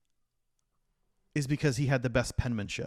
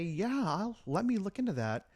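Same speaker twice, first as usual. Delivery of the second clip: higher-pitched, politely noncommittal reply; mock polite brush-off; 7 semitones higher than usual. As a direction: put on a polite voice to fob someone off. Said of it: "politely say".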